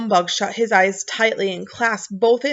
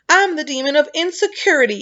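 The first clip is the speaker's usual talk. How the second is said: sobbing; 8 semitones higher, 3 dB louder than usual. A narrator's reading. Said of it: "he sobbed".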